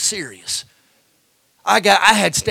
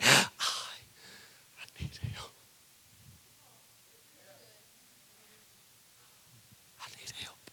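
near-silent whisper, long pauses; whispered plea; 5 semitones higher, 16 dB quieter than usual. Acted. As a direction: drop to a bare whisper. Whispered confession after shouting.

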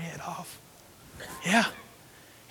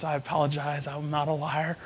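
ordinary delivery

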